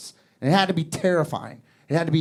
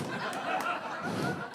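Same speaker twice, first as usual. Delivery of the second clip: high breathy giggle; 15 dB quieter than usual. Retold or imitated. Man's gleeful laugh.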